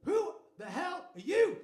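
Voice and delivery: booming, high-pitched mock outrage; angry shout. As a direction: act out a furious homeowner catching an intruder. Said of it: "he's really angry".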